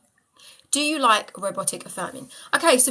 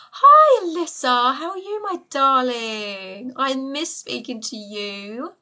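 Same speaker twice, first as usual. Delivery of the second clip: high, drawn-out, doting hello; affectionate greeting; 4 semitones higher, 8 dB louder, much slower than usual. Real, not acted. Greeting Alyssa, a missed friend on the live, affectionately. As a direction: stretch the words out fondly, pitched up.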